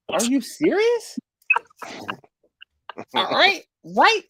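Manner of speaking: high, slow are you serious; disbelief. Real, not acted. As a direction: raise the pitch in disbelief. Reacting to demanding customer.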